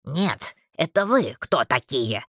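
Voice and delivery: low creaky grumbler; querulous creaking character; creaky, cantankerous. A narrator's reading. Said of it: "склочно поинтересовался скрипучий голос".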